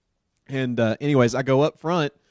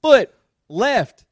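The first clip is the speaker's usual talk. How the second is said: louder, higher urgent order; urgent command; 9 semitones higher, 8 dB louder than usual. Retold or imitated. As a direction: bark the order quickly. Commanding panicking driver.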